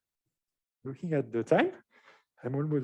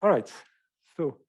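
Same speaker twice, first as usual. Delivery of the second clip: slightly winded, catching breath; 2 semitones higher than usual. Real, not acted. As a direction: talk while still short of breath. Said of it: "catching my breath a bit".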